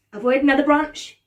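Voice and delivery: plain reading voice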